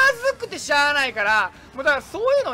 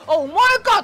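surprised recognition; 6 semitones higher, 7 dB louder than usual. Retold or imitated. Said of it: "とか言って".

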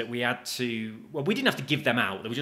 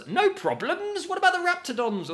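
incredulous; 4 dB louder than usual. Real, not acted. Gamer mocking the NPC's boast, incredulous. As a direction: throw the phrase back in total disbelief. Scoffing at the game's claim.

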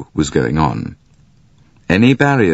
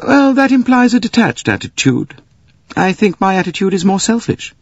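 put-on a higher, composed young woman; cool high voice; 12 semitones higher than usual. Mary Debenham calmly answering Poirot, voiced higher. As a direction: speak it evenly and detached.

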